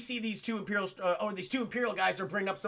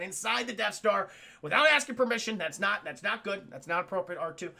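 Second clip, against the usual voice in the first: ordinary delivery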